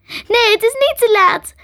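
high, loud tearful protest; crying out. A narrator's reading. Ellen to dying Crystal, tears streaming, crying out. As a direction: protest desperately through tears. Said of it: "huilde Ellen".

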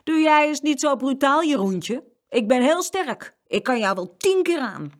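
put-on high, loud boy's swagger; puffed-up threat. A narrator's reading. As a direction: face him down, boasting of strength. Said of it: "zette een hoge borst op".

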